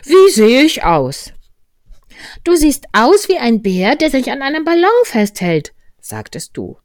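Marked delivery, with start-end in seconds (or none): 0.0-1.3 high, loud, drawn-out call; Winnie der Pooh. A narrator's reading. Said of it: "rief der Winnie von dort oben zu". 2.4-5.7 put-on high, loud child's voice; little child. Voices Christopher Robin answering.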